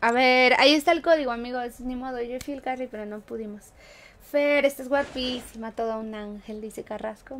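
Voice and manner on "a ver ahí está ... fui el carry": normal talking voice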